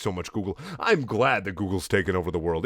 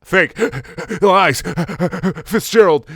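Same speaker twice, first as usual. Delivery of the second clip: loud high words between gasps; hammed-up breathless outbursts; panting heavily; 9 semitones higher, 7 dB louder, slower than usual. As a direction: gasp out each word as if winded. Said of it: "panting heavily before giving the info".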